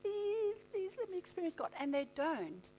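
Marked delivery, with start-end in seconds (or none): 0.0-1.7 soft, begging whisper-quiet; mock hushed pleading. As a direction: plead under your breath. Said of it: "laid on the carpet for hours".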